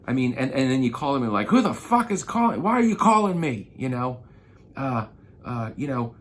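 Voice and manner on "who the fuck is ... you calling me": high, loud mock angry "who's calling"; hammed-up angry caller; annoyed lead. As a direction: impersonate a furious lead on the phone. Imitating a typical angry lead.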